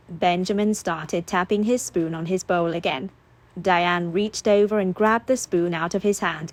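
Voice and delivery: usual speaking voice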